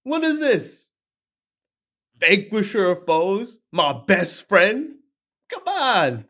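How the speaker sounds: high, incredulous "stop whining" razzing; mock disbelief of a buddy; best friend voice. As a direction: friend teasing Krishna-style, high and incredulous. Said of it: "how your best friend talks to you".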